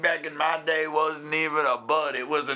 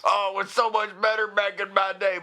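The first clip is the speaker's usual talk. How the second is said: put-on old-timer voice; 7 semitones higher than usual. Mocking old-timer nostalgia.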